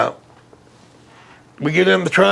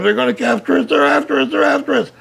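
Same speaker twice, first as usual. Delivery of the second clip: higher-pitched mock terror over a sobbing buddy; imitating crying friend; 8 semitones higher than usual. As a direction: retell his friend's teary panic, pitched up. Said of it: "he was crying and stuff".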